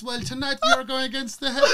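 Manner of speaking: high, hammed-up wrestler proclamation; Nacho. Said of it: "Nacho Libre".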